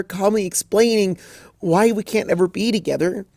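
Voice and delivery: high, pleading despair; miserable Twilight. Acted. Twilight refusing Rarity's comfort, high and miserable.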